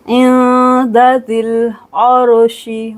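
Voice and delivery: slow, drawn-out tajweed chant; Quranic recitation. Shown as a demonstration. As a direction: recite it slowly. Recites verse. (धीमी तजवीद तिलावत)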